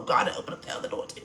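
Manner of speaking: hushed, quick, breathy plea to God; whispered prayer. Real, not acted. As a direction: whisper it fast, a private prayer.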